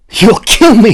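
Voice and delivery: very high, loud cry; panicked protest. A narrator's reading. Clove protesting to the unicorn, panicked. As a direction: protest in fear. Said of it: "he protested".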